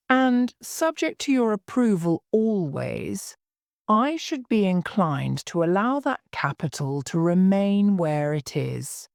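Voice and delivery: plain reading voice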